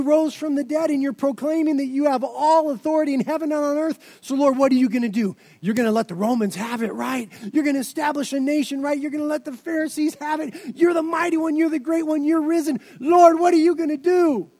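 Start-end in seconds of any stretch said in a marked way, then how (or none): 4.2-14.5 eager disciple. Pretend. Disciple pressing the Lord about the Romans, fast and building to a shout. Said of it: "if you were the disciple".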